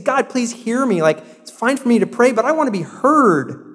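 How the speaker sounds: high-pitched, earnest plea; crying out to God. Acted. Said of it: "He's crying out to God".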